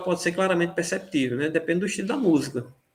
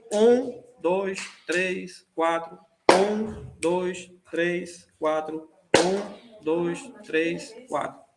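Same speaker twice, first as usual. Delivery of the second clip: slow even count in fours, "um" raised above the rest; counted quaternary pulse; much slower than usual. Shown as a demonstration. Teacher counting quaternary measure for students, accented first beat. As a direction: count "um, dois, três, quatro" as spaced beats, accenting "um". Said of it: "Acentos que se repetem a cada quatro pulsos".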